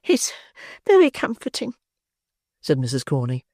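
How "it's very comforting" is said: very high lady's voice; matron. Acted. Matron telling the beadle the drink comforts her. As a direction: purr it contentedly.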